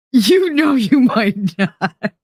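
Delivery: slow, laughing it out; teasing chat she might not, laughing slowly. Shown as a demonstration. Repeats the line with a laugh, slow.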